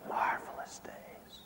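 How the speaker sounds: breathed out in a soft whisper; wistful whisper. Acted. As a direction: let the memory fade into a whisper. Wistful memory of blessed days.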